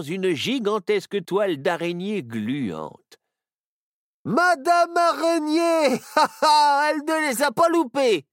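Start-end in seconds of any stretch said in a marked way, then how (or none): 4.2-8.3 high, loud laughing cheer; Barnabé cheering the spider's catch. A narrator's reading. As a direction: laugh with delight. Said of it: "rigola Barnabé".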